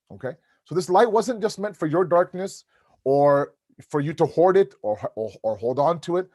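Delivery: ordinary talk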